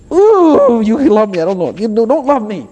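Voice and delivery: hammed-up high wail of disbelief; overwhelmed wail. Sinner overwhelmed by God's love, acted wail. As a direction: wail out high, then keep protesting.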